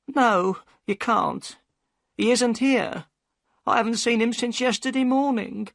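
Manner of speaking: high, anxious woman's voice; a worried wife pushing him away; upset boatman's wife. A narrator's reading. Mrs Smith's voice, upset.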